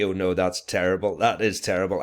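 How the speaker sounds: ordinary delivery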